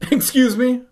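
high, loud startled excuse me; shocked gasp. A narrator's reading. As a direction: gasp it out in disbelief. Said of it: "I gasped".

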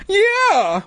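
put-on very high, squeaky kid demand. A narrator's reading. Hero backing up Terry, squeaky demand. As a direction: pipe up insistently in a kid voice. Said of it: "I demanded".

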